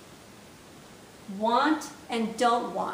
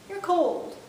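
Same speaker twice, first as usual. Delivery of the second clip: you're cold pitched up for really cold; emphatic cold; 6 semitones higher than usual. Shown as a demonstration. Teacher showing very cold. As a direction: push cold up high. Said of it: "How about if you're really cold".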